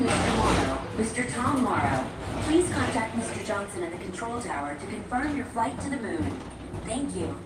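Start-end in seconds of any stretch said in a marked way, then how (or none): none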